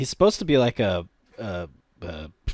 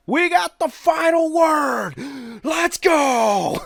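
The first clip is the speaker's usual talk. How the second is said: very high, drawn-out whoop; mock victory yell; 13 semitones higher, much slower than usual. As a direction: whoop it like rejoicing Scots. Voicing celebrating Scots.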